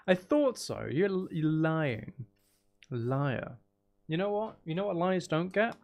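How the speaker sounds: ordinary talk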